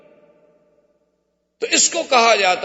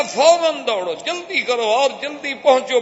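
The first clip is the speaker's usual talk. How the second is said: urgent, raised command to chase; urgent order; 6 semitones higher than usual. Acted. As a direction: push them to hurry. Abu Jahl urging pursuers.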